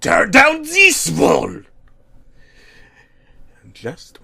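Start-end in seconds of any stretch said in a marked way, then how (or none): 0.0-1.6 much higher, loud, slow bellowed roar; hammed-up villain yell. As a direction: roar it like a villain's battle cry. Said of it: "he let out a yell of".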